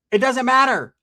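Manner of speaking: high, loud dismissal; imitating a hostile woman on the phone; dismissive retort. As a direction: shut the caller down. Woman snaps back at caller.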